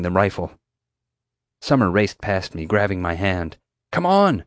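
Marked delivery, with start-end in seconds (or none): 3.9-4.4 high-pitched hurrying call; raised, pressing voice; urgent shout. A narrator's reading. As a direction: urge a friend to run. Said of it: "she shouted".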